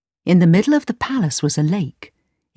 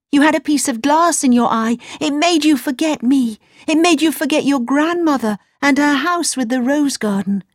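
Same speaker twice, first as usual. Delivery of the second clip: put-on higher little-girl voice; earnest girl explaining; 7 semitones higher than usual. A narrator's reading. Gerda explains to Kay what the glass did, earnest. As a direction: explain eagerly, pitched up.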